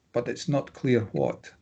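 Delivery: ordinary delivery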